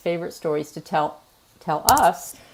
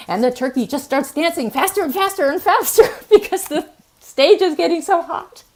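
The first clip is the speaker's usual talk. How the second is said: higher, laughing while finishing the punchline; laughing through it; 9 semitones higher, 5 dB louder than usual. Real, not acted. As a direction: giggle your way through the end.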